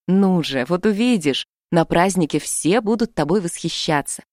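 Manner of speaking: ordinary delivery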